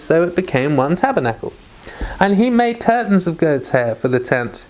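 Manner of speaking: steady, measured Bible reading; scripture reading. A narrator's reading. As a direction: read the passage out evenly, verse by verse. Reads scripture aloud before commenting.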